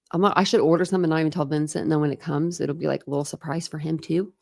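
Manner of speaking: ordinary talk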